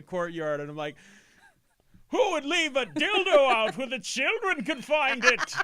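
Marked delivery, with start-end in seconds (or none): 2.1-5.5 high, loud, slow outraged yell; yelling outrage. Pretend. Pretending to yell across the empty courtyard, outraged shout. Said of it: "you're yelling it at the top of your lungs".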